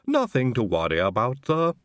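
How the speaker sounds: lightly voiced cheerful servant; a touch of cheer; lightly cheerful reassurance to the master; cheerful Igor. A narrator's reading. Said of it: "said Igor cheerfully".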